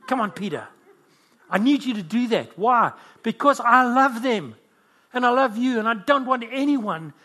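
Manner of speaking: high, loud preaching plea; impassioned urging. Acted. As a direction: press the appeal with rising force. Voices Jesus urging Peter with passion.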